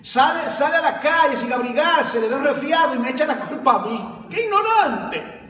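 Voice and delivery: laughing scorn, then a shout; mocking laughing devil. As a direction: play the devil laughing at a foolish believer. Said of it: "el diablo riéndose".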